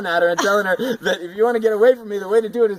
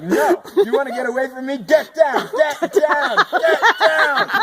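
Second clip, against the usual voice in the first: building yell, higher and louder each time; yelling at a cat; 8 semitones higher, 4 dB louder than usual. Retold or imitated. Owner chasing the cat out of the tree, yelling it down. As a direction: shout the cat down the tree, getting more insistent. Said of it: "I was like".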